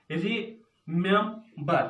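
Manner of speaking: "Remember" slowly split into रि, मेम, बर; a clear gap between each syllable of "remember". Shown as a demonstration. Teacher taking the example again for division, "remember" in separate syllables. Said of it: "तीन syllable division है".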